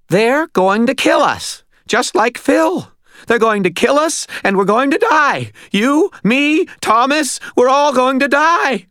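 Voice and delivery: high, loud, wailing panic; panicked hysteria. A narrator's reading. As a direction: wail it out in mounting terror. Butters in panicked meltdown.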